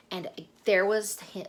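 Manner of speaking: ordinary delivery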